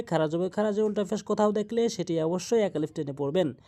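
usual speaking voice

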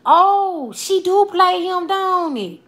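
high, drawn-out dawning surprise; sudden realisation. Real, not acted. Streamer realises who plays Riley, surprised. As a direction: let it dawn on you, high and slow.

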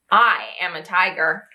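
low, proud tiger voice; a big tiger insisting he is a tiger. Acted. Tiger character in picture book.

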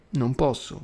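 usual speaking voice

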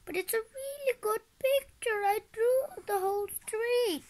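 put-on high, insistent child's whine; whining. A narrator's reading. Ross defending the picture to Alec, whining high. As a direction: protest like a little kid. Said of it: "Your whining is hurting my ears".

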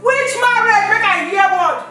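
loud, high furious tirade; furious shouting. Acted. Wife shouts down husband over the marriage threat, furious and loud. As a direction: blast him with scorn and rage.

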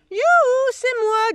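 very high, loud yoo-hoo through the door; singsong yoo-hoo call. A narrator's reading. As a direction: sing out to announce yourself. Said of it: "dit une autre voix". (youhou chantonné très aigu)

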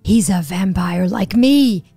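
menacing vampire. Pretend. S0 voices the vampire locking them up.

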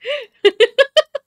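shrieking giggle; high laugh. Real, not acted. Giddy laugh after squeal.

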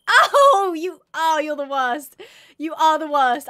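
loud exasperated scolding; laughing outrage. Real, not acted. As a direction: berate the mob, half laughing. Mob wakes her up.

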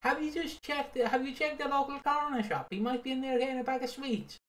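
mock the daft suggestion; mocking busybody. Host mocks searchers in a high voice. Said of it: "people being like".

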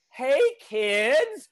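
put-on high, drawn-out old-lady greeting; playful little old lady. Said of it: "like, a little old lady".

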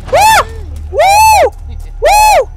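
loud falsetto whoops, very high; high-pitched whooping. Real, not acted. Running from game enemies, loud high whooping. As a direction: whoop three times as you escape.

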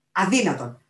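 loud, outraged exclamation; outraged refusal. A narrator's reading. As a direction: refuse out loud, before the full howl. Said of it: "ούριαξε ο Οράτιος Μπατ".

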